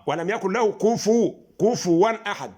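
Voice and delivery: كفوا with the فاء clearly voweled with damma, slow and firm; careful Quran recitation; recitation demo. Shown as a demonstration. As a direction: recite كفوا أحد pointing the ضمة on the فاء. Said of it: "الفاء لها ضمة".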